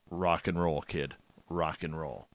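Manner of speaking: low, softening proud-dad reply; quiet approval. Retold or imitated. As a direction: drop low and give the kid your blessing. Dad's approving reply to son, retold.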